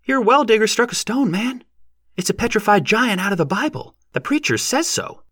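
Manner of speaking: very high-pitched excited villager; eager yokel in falsetto; shouted explanation. A narrator's reading. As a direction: call it out in a high, thin voice. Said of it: "voices shouted explanations".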